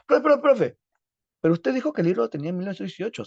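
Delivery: high, loud eager student interrupting; student objecting. Acted. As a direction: jump in like a pupil catching a mistake. Alumno imaginado interrumpe al profe. (alumno interrumpiendo al profe, agudo y fuerte)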